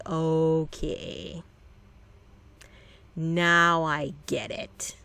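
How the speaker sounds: slow, drawn-out realization; dawning realization. Real, not acted. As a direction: let it sink in slowly, deadpan. Realizing the dog's real problem.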